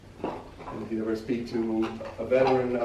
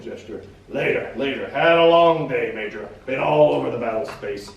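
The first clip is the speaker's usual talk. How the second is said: measured reading of a war memoir; story narration; 3 semitones higher, 4 dB louder than usual. A narrator's reading. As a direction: read it steady, let the scenes land. Reads book excerpt aloud.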